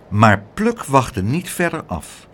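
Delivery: ordinary delivery, nothing marked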